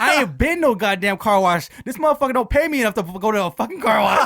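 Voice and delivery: loud, high, indignant rant; mad. Retold or imitated. Underpaid cook denying car wash visit, mad. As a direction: imitate a fed-up worker ranting. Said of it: "He was so mad at me".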